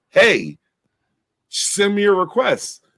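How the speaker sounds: high-pitched mock asking voice; mocking request. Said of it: "fixed your mouth to be like".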